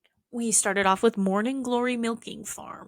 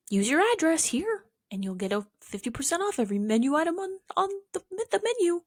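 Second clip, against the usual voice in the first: mock ad voice; 8 semitones higher, faster than usual. Mocking pizza ad copy.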